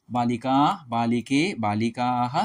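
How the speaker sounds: slow, deliberate chanting of forms; slow recitation. Shown as a demonstration. Teacher reciting Sanskrit noun forms to students, slowly. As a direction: spell out each form slowly for students.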